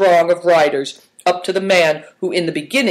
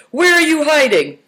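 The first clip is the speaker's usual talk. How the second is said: high, loud playful call; 12 semitones higher, 5 dB louder than usual. A narrator's reading. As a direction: call out to someone hiding. Calling to hidden boys.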